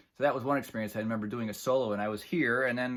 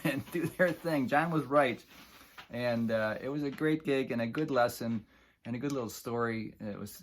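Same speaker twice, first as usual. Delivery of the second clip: usual speaking voice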